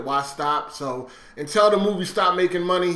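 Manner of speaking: ordinary talk, nothing marked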